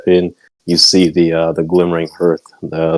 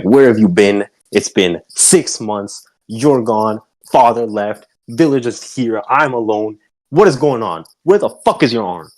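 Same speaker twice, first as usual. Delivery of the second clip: raised, scolding mother ranting at her daughter; angry mother's tirade; 5 semitones higher than usual. Acted. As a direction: fire off questions like a furious mom. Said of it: "She'll be like".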